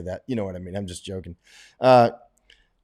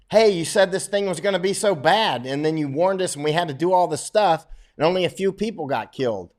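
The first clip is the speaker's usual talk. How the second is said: higher, whiny mock grievance; mock complaining public; 7 semitones higher, 3 dB louder than usual. Public griping to epidemiologists, mock whiny. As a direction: imitate a griping citizen, lightly. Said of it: "They're like".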